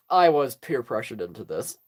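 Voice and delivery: low, subdued mock-reluctant reply; deadpan aside. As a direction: drop it low after the cheering, imitating reluctance. Deadpan reply after the cheering.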